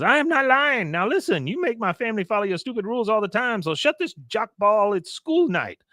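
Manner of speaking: high-pitched mock indignation; Con protesting. Neighbor fed up with Hank's rules, high indignant scolding.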